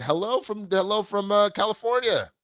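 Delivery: high, excited greeting, pitch way up; bright excited hello. Real, not acted. Streamer greets a New Zealand fan, excitedly high. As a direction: beam out a hello to a far-off fan. Cheerful greeting to viewer abroad.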